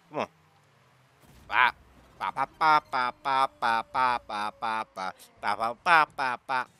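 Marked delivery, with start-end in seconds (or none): 1.5-6.7 steady staccato "ba" run stepping down in pitch; playful percussive babble; rhythmic ba-ba noises. Real, not acted. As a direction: pop out a string of even bas. Idle vocal noises during play.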